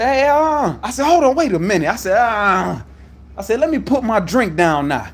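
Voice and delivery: high, loud warning; retold yelling. Him yelling at the dispatcher before putting his drink down, loud. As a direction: yell at her to stop. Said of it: "Now I get the yelling".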